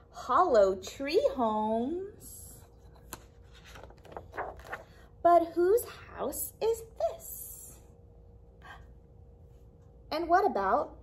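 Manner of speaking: lively, expressive storytime read-aloud; reading to children. Acted. Librarian reading a picture book to kids, lively storytime voice.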